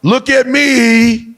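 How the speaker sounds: loud, very slow look-at-me; attention-seeking mock call; showy guest. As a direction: drag it out like a show-off.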